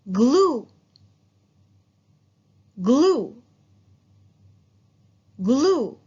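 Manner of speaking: glue called out loud with a long oo; model word glue. Shown as a demonstration. Teacher drills glue with the class, loud and clear. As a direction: belt out glue for the class to copy. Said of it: "One more".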